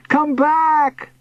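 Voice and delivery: high, urgent call upward; shouting. A narrator's reading. Boy to uncle in the sky, shouting. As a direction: shout after someone drifting away. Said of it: "I shouted".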